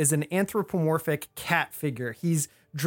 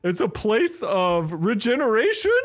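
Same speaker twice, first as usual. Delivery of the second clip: lightly voiced host, raised pitch; showman cat host; 6 semitones higher, 3 dB louder than usual. Cat host pitches the bazaar, mildly showy. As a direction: pitch the place with a mild showman lilt.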